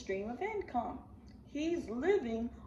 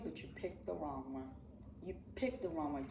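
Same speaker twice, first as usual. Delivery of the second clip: low and soft menace; quiet steely warning; cold warning; 5 semitones lower, 7 dB quieter than usual. Real, not acted. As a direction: drop it to a quiet threat. Quiet threat after outburst.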